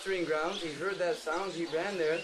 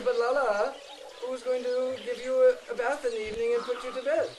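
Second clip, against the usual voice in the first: coaxing father; 5 semitones higher, 5 dB louder than usual. Acted. Father coaxing his son.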